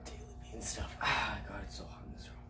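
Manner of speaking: unvoiced whispered aside; whispering. Real, not acted. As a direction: whisper it under your breath.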